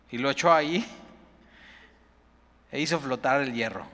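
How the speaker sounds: usual speaking voice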